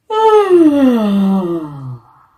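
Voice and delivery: big open yawn, loud; demo yawn. Shown as a demonstration. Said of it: "Do a couple yawns".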